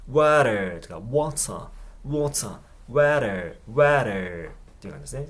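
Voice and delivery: water with the T tapped like a quick D; water said as wader, flapped T. Shown as a demonstration. Teacher showing the American flap in water. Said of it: "DとかRっぽい".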